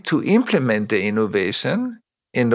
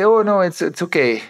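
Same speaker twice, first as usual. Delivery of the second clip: higher, quick dismissive reassurance; breezy brush-off; 5 semitones higher than usual. Retold or imitated. Leader brushing off a worried employee, breezy. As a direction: wave the worry away lightly.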